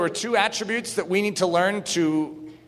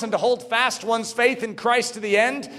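ordinary delivery

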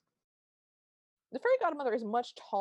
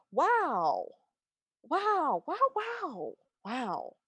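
wows climbing much higher, then dropping away; awestruck wows; 6 semitones higher, much slower than usual. Retold or imitated. [name] turns to Cinderella, wowing ever higher in awe. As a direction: gawk more with each wow.

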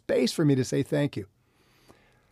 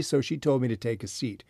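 ordinary delivery, nothing marked

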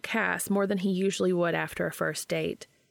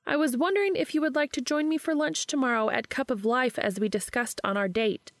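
higher voice, mock-formal reading of a text; Charlie, teasing friend; 7 semitones higher than usual. A narrator's reading. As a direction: tease him by reading it out.

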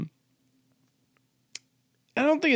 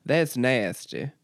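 slow Southern drawl attempt; Southern accent; 3 semitones lower, slower than usual. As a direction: draw it out with a Southern twang. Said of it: "isn't a word for Southern people".

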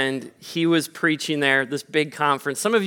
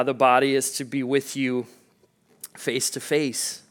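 earnest prayer; 4 dB quieter than usual. Real, not acted. Pastor praying for a grieving family in a soft, reverent voice. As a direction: pray quietly, with reverence and care.

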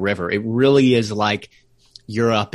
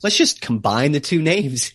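higher-pitched, lightly acted puzzling it out; imagined city planners; 6 semitones higher than usual. Guest imagining founders choosing a city name, lightly acted deliberation. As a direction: lightly voice a committee debating, then landing on an idea. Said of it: "were they thinking".

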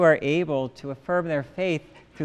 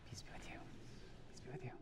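hushed whisper; 26 dB quieter, much faster than usual. Real, not acted. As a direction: whisper the greeting to a neighbour. Exchanging the sign of peace.

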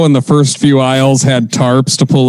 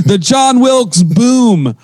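announcer slogan; 8 semitones higher, much slower than usual. Pretend.

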